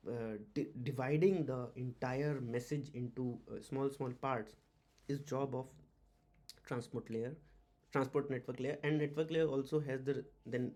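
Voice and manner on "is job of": usual speaking voice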